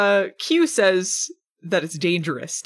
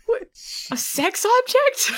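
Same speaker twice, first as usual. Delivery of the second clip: incredulous. Real, not acted. Incredulous repeat.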